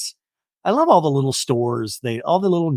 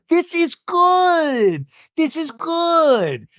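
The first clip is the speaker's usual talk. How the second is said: much higher, very slow sing-song; sing-song cheer; 12 semitones higher, much slower than usual. Retold or imitated. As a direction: coo it out brightly. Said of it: "And Heather's like".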